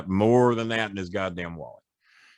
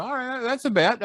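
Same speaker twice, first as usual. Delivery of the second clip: high, eager agreement; excited agreeing; 13 semitones higher than usual. Retold or imitated. Quoting himself to Flair, high and eager. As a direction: jump at the bet, pitched way up.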